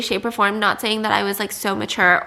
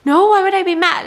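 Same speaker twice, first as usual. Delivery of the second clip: higher, louder puzzled protest; confused, can't see why; baffled fiance quote; 7 semitones higher, 5 dB louder than usual. Retold or imitated. Playing her fiance answering her, baffled and higher. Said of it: "And he'd be like".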